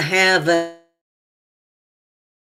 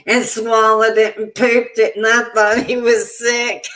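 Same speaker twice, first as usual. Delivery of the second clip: higher, louder words through laughter; laughing while telling; 5 semitones higher, 3 dB louder than usual. Real, not acted. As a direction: tell it as the laugh takes over. Laughing at dog swallowing thong.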